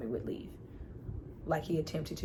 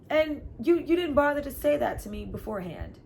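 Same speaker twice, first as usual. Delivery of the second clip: indignant outburst; 9 semitones higher, 5 dB louder, faster than usual. Real, not acted. Indignant rant at Kwame.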